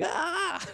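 mock yell. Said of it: "and then I just was like".

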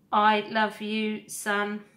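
a steady, tender robot mother. Acted. Robot mother answers her son.

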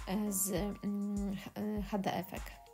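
ordinary delivery

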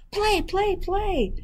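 very high, eager gosling cries; excited baby goose. Acted. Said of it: "cried the excited baby goose".

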